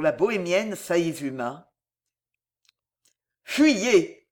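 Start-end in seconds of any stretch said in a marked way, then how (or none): 3.4-4.3 louder, frantic command; beside himself. A narrator's reading. Cardinal ordering the young man away, frantic. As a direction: order them off, wild with grief. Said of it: "reprit le cardinal hors de lui".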